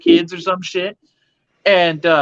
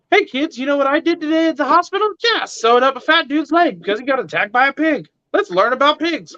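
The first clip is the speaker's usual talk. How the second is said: mock cheerful dad; 10 semitones higher than usual. Imagined doctor telling his kids.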